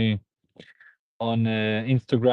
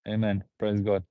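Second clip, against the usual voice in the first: ordinary talk, nothing marked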